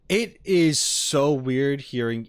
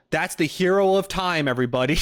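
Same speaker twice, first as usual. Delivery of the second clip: higher, louder mock-grand introduction; mock announcer; 6 semitones higher, 5 dB louder than usual. Streamer joking about renaming the hero, mock announcement. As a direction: proudly present a ridiculous hero.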